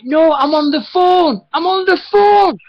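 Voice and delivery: high, loud, stretched-out protest; re-enacted yell, drawing out each phrase; exasperated retold protest. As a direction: fend someone off, slow and high. Retold fending off the pest.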